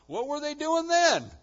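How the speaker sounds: high, loud, disbelieving burst; incredulous exclamation. Real, not acted. Preacher marvelling at the latecomers, incredulous loud question. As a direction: throw the question out in astonishment.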